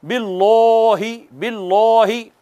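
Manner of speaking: बिल्लाही with a heavy, rounded ल, lips rounded; deliberately thick बिल्लाही, said the wrong way; wrong heavy Allah. Shown as a demonstration. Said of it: "इसको यूं नहीं कहना".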